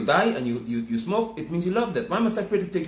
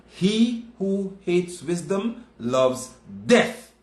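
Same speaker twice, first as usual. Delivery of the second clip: slow solemn scripture; 3 semitones higher, much slower than usual. Acted.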